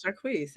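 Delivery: "Jacquees" with a long EE and a final Z; Jacquees said zha-KWEEZ. Shown as a demonstration. Co-host checking the name, "[name]" as zha-KWEEZ. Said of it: "That's what I thought it was".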